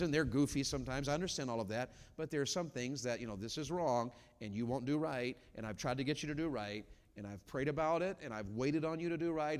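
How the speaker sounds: ordinary talk, nothing marked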